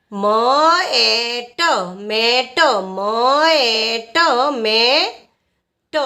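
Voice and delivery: મ, એ, ટ separately, then blended into slow મેટ; sounding out. Shown as a demonstration. Teacher teaching learners to read met, sounding out. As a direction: sound each letter, then stretch મેટ. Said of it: "એમનું ઉચ્ચારણ થશે મ".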